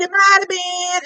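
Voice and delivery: lightly voiced sheepish boyfriend; imitating Keith. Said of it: "And he said it just like that".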